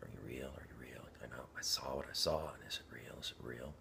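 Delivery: hushed, soft whispered pleading; breathless hushed asking; whispered questions. Retold or imitated. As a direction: whisper it like asking God in private.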